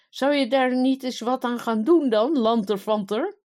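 loud, high, indignant tirade; scolding. A narrator's reading. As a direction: berate the idle minister angrily. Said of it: "Hij stond te schelden". (luid en woedend scheldend)

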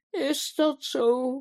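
high, drawn-out tearful quaver; trembling voice. A narrator's reading. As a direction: ask slowly, close to tears. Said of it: "met bibberende stem". (met bibberende stem)

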